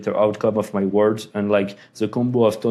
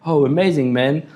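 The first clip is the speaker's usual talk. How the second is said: mock cheerful reply; 4 semitones higher than usual. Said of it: "I was".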